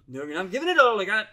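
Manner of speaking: strained Scottish engineer pushing the engines; Scottish accent. Retold or imitated. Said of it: "that'll be my worst Scottish accent there".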